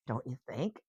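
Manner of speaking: ordinary delivery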